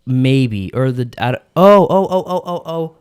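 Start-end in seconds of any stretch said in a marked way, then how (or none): 1.6-3.0 it dawns on you mid-sentence; sudden realization. Real, not acted. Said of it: "got it".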